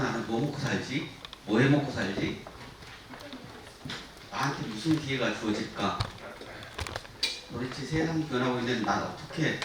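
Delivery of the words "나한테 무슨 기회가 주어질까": ordinary talk, nothing marked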